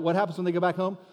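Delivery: ordinary delivery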